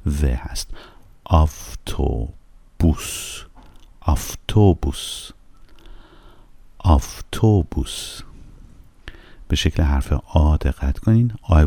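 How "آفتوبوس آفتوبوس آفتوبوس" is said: slow, careful Russian "آفتوبوس" with a light v/f on the second sound; Russian avtobus. Shown as a demonstration. Teacher modelling "آفتوبوس" for students to repeat. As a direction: say "آفتوبوس" slowly, stressing each syllable for learners. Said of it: "با کلمهٔ آفتو، آفتو میاد".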